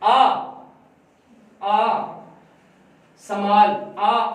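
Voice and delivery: falling drawn-out vowel demo. Shown as a demonstration. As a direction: stretch आ long and slow, pitch falling. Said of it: "गिरा रहे हैं आवाज को".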